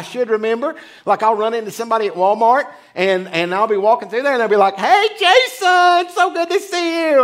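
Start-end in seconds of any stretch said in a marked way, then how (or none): 4.8-7.2 high, drawn-out friendly greeting; a sing-song hello from someone he can't place; cheery acquaintance. Retold or imitated. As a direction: play the overly cheerful acquaintance. Acquaintance greeting him at Walmart.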